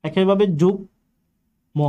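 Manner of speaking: ordinary talk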